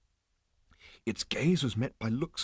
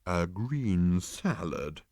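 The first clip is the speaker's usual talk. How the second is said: disapproving; much slower than usual. A narrator's reading. Animal repeating Arthur's order, slow and disapproving. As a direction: repeat it back with slow disdain. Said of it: "rolling his eyes disapprovingly".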